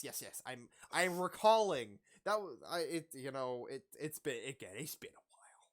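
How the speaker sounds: usual speaking voice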